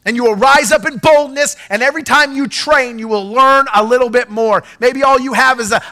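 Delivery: loud, high declaring preacher voice; preaching at full force. Acted. Pastor declaring over his congregation, shouting. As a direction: proclaim it over the room with fire.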